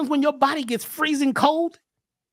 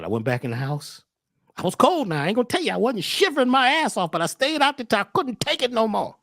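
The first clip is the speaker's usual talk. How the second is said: usual speaking voice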